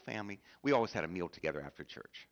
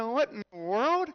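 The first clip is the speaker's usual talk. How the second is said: astonished; 11 dB louder, slower than usual. Retold or imitated. Pastor's retold astonished reply.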